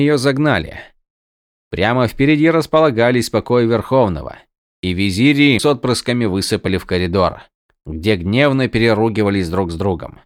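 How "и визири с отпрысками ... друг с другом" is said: usual speaking voice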